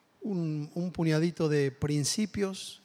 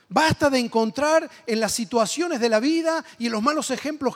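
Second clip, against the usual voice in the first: loud, high, driving sermon climax; preaching at full force; 6 semitones higher, 5 dB louder than usual. Acted. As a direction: drive it home to the congregation with fire.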